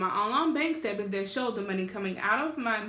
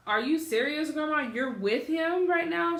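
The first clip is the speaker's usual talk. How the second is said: higher-pitched retold indignation; incredulous, heated, rising in pitch; flipping out; 6 semitones higher than usual. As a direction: reenact an indignant challenge to grandma. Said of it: "So I flipped my lid".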